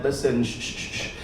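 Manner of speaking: soft shushing, fading to a whisper; gentle comforting shh; soothing hush. Retold or imitated. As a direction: hush him like calming a crying kid. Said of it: "and I said".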